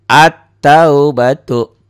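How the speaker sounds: At-taubatu with the al silent, slow and loud; drawn-out recitation. Shown as a demonstration. Teacher showing pupils the silent lam, slow and loud. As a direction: stretch At-taubatu into a doubled t. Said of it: "Alif lamnya tidak dibaca".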